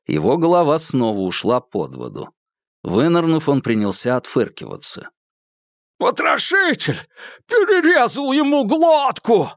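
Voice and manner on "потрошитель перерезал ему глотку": high, frantic pleading voice; desperate frozen man. Acted. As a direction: beg for life, freezing in the river. Malcolm begs from icy water. (отчаянная мольба замерзающего)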